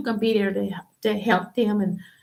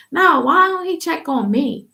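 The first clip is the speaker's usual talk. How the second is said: higher and louder, hurt and fed up; indignant outburst; 7 semitones higher, 5 dB louder than usual. Real, not acted. A daughter fed up with her father, loud and indignant. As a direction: demand it, loud and high.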